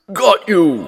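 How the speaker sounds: monster shout. A narrator's reading. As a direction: yell it as he snatches. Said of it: "shouted the monster".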